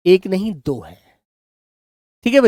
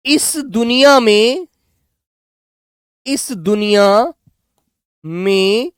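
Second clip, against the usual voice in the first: drawn-out words with pauses for writing; teacher dictating an example sentence slowly; slow example read-out; slower than usual. Shown as a demonstration. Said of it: "मैंने कहा".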